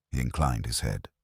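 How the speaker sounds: usual speaking voice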